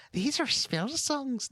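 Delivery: high, slow, lilting put-on Irish; mock Irish accent. Podcaster mocking a character's Irish brogue, high and slow. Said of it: "No, that's more Irish".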